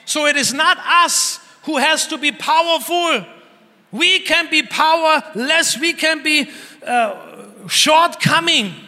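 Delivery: preaching climax. Real, not acted. A preacher urging the church that God is their strength, loud and high. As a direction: lift the congregation with rising conviction.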